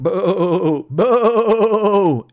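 bleated booing, drawn out; sheep heckler boo. Acted. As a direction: boo like a jeering flock of sheep. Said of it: "then they're really loud".

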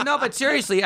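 high, laughing through the words; half-laughing plea; words broken by laughter. Retold or imitated. Laughing while quoting himself.

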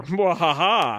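slow, hammy villain laugh; mock evil cackle; maniacal laugh. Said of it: "laughs maniacally".

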